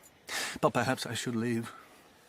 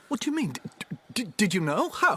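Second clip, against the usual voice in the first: much higher, stammering disbelief; startled question; 10 semitones higher, 5 dB louder, faster than usual. Acted. Doctor stunned she already knew his love. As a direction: catch your breath and stumble.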